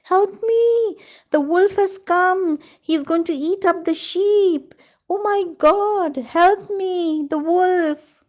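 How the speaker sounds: high, loud panicked calls for help; shouting for help. Acted. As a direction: cry out to the village as if the wolf is there. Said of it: "he started shouting".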